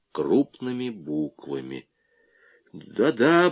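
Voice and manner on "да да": higher, mildly flustered assent; nervously agreeable; flustered babble. A narrator's reading. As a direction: babble it out, a little thrown. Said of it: "пролепетал он".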